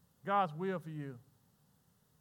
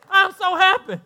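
joyful; 5 semitones higher, 8 dB louder than usual. Real, not acted. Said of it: "And I'm so happy".

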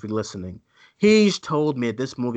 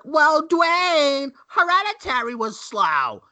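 high mock-whiny voice, much higher and louder; mocking everyone; 11 semitones higher, 4 dB louder, slower than usual. Host mimicking people who call Hereditary slow, high mock voice. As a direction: parrot the crowd's objection in a put-on high voice.

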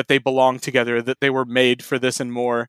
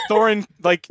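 laughing; 8 semitones higher than usual. Real, not acted. Laughing at the fan fiction.

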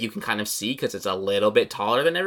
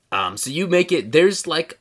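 normal talking voice

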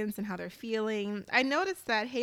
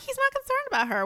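fast, much higher, exasperated; indignant outburst; faster than usual. Real, not acted. Calling out a self-centred husband, fast high outburst. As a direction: fire it off high and fast in disbelief.

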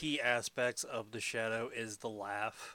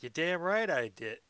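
tough mobster voice; 5 semitones higher, 6 dB louder than usual. Retold or imitated. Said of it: "he's like".

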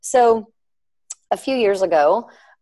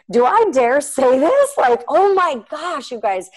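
high, drawn-out exclamation; mock-scandalized, slow and raised; dramatic disbelief; 8 semitones higher, 4 dB louder, slower than usual. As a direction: stretch it out, loud and high, then settle. Reenacts her dramatic hesitation.

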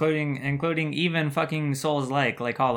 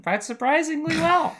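mock high voice; 4 dB louder than usual. Mocking the game's performance.

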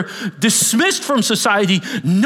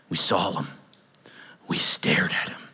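awed whisper; 14 semitones lower, 7 dB quieter than usual. Real, not acted. Whispered in awe.

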